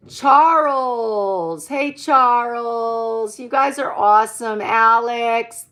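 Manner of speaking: loud, drawn-out hellos to viewers; loud streamer shoutouts; shoutouts to chat. Real, not acted. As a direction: greet each name big and warm.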